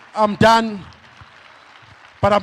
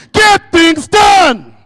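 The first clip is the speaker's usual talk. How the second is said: high, slow, booming rally cry; shouted slogan; 7 semitones higher, 12 dB louder, much slower than usual. Shown as a demonstration. Speaker calls the slogan for the crowd to repeat, booming. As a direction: roar it to fire up the room. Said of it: "Say this after me".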